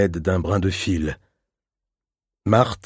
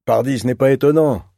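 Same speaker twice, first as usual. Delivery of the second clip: teasing exclamation, pitched higher; cried out; 5 semitones higher, 4 dB louder than usual. A narrator's reading. Teenage brother teasing his little sister from the terrace, raised and high. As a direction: call it out mockingly from the terrace rail. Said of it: "cria Octave".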